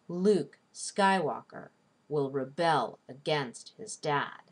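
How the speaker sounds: slow, marking LUKE, SKY-walker, re-BEL, a-GAINST, DAD; exaggerated syllable stress. Shown as a demonstration. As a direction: beat out the stresses of "Luke Skywalker will rebel against his dad". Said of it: "exaggeratedly stress the stressed syllables".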